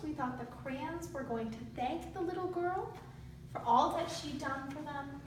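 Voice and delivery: ordinary talk, nothing marked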